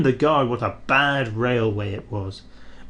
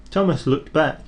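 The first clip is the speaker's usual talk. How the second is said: ordinary delivery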